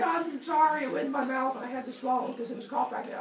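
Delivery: fast high babbling sorry; rushed high apology. Pretend. Kronos fake apology.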